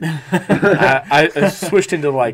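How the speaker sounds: giggling embarrassed; laughing. Real, not acted. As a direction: laugh through the words. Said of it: "Just started making words".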